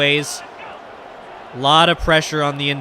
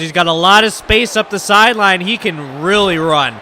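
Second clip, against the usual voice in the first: excited play-by-play; 4 semitones higher, 6 dB louder than usual. Real, not acted. Live play-by-play of a long run.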